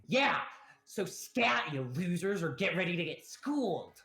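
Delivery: put-on high, loud bratty boy; high, punchy childish threat; young boy's voice. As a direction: challenge them like a cocky kid. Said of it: "is immediately saying".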